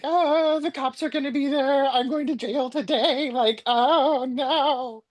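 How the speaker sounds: high mock wail of doom; exaggerated despair, ending in a long drawn-out "oh no"; retold dread. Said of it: "when I'm going through it".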